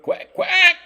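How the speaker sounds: very high, loud squawked quack; duck quack. Pretend. Cook playfully quacking over his roast duck, imitated duck squawk.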